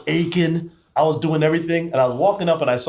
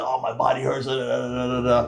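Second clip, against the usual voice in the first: softer, whiny mock moan; mock whining; 2 semitones lower, 4 dB quieter than usual. Mocking his own sick complaining, soft whine. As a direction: make fun of your own self-pity.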